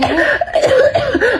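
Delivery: hammed-up fake sick cough; badly faked high cough. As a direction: cough like you're faking being sick to skip school. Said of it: "for the best actress ka dyan".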